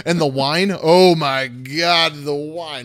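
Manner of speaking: loud, savouring delight; hammed-up food ecstasy; rapturous gushing. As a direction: swoon over it, drawing out the praise. GM gushing over the feast.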